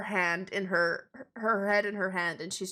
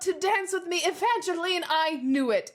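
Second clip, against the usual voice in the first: high, crowing self-assurance; Duke; 9 semitones higher, 4 dB louder than usual. Acted. Duke turns to an unwilling Evangeline, high smug gushing. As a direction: gush as if you have won her. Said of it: "looks at Evangeline and goes".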